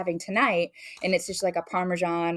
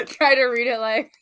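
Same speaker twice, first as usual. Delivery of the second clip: words pushed out through laughter; laughing; 7 semitones higher, 6 dB louder than usual. Real, not acted. Laughing while asked to do a Texan accent. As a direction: try to talk while cracking up.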